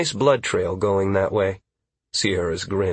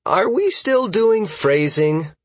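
slow, higher wary question; cautious; 10 semitones higher, slower than usual. Acted. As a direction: ask it slowly and warily. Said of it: "Sierra asked cautiously".

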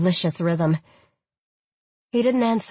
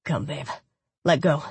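lightly voiced tender lover; 3 semitones lower than usual. A narrator's reading. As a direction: coax her gently. Khan voiced by narrator.

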